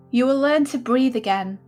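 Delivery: normal talking voice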